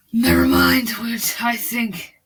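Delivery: drawn-out loud declaration; loud and slow. Acted. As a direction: proclaim it slowly and loudly. Loud slow line, later whispered variant.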